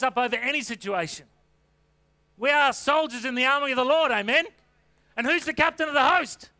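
full-force preaching. Real, not acted. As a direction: call it out to the congregation.

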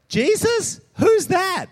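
high-pitched, loud incredulous question; feigned ignorance. Acted. Preacher mimics scared disciple denying Jesus, feigned ignorance. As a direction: play dumb in a high, startled voice. Acting out a scared man's denial.

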